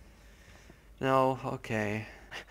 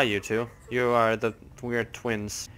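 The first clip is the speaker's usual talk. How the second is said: usual speaking voice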